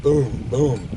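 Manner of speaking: punchy gun noises with the mouth; mock gunshot booms. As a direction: blurt out two loud booms. Mimicking rifle shots in story.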